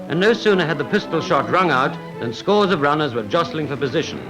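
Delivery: ordinary delivery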